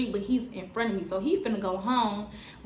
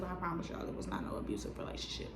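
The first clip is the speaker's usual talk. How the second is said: whispered aside; 4 semitones lower, 13 dB quieter, faster than usual. Real, not acted. Aside reassuring viewers.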